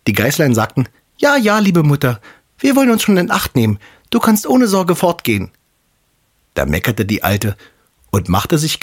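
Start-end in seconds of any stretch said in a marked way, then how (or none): 1.2-5.5 put-on high, piping kid-goat chorus; obedient little kids. A narrator's reading. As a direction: reassure mother in a much higher child's voice. (brave Geißlein mit hoher Stimme)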